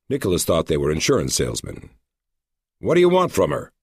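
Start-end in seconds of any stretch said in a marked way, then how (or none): 2.8-3.7 high-pitched hostile challenge to strangers; hostile demand. A narrator's reading. Husband confronting strangers asking after his wife, hostile. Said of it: "he demanded with hostility".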